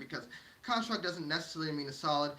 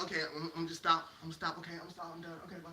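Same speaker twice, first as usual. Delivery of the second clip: much softer, trailing-off compliance; meek surrender; 3 semitones lower, 6 dB quieter, much faster than usual. Acted. Person giving up under criticism, acted meek surrender. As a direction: back down and fade away almost to nothing.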